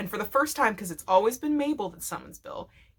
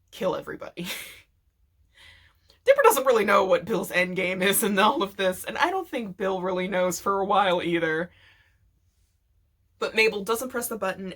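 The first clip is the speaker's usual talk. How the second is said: normal talking voice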